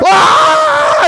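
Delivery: long, high, loud crowd yell; wild sustained shout of praise; ecstatic scream. Acted. Acting overwhelmed crowd throwing things.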